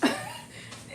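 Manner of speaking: airy laugh, way up high; a squeaky unvoiced giggle; high breathy laugh. Real, not acted. Owner giggling at her dog, high and airy.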